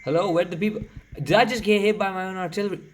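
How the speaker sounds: high, loud and fast, caught off guard; shrill shocked cry at own artillery; shocked disbelief. Real, not acted. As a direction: yelp it out in disbelief.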